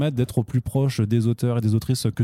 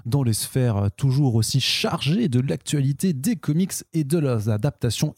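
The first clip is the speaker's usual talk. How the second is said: podcast intro voice; 5 semitones higher, slower than usual. Acted. Podcast intro over music.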